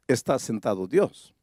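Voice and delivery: ordinary talk, nothing marked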